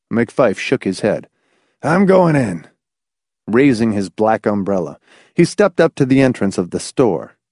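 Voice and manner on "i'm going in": determined. A narrator's reading. McFife's resolve at the church.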